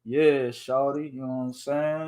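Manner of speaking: put-on slow, low, soft crooning at a girl; mock smooth talker; smooth macking voice. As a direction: lay the charm on slow and low. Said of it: "talks to men like he macking".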